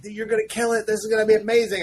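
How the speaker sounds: high-pitched imitation of a gushing cheerleader friend; Jeff Johns. Friend encourages nervous writer about new job, imitated as excited. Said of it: "he's such a cheerleader".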